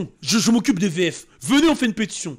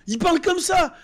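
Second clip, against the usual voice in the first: laughing shout; 10 semitones higher than usual. Real, not acted. Streamer laughing at the bad French dub. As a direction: laugh and yell it.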